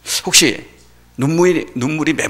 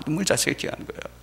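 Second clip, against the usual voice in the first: ordinary talk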